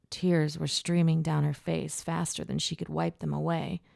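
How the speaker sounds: ordinary delivery, nothing marked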